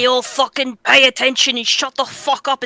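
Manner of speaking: ordinary talk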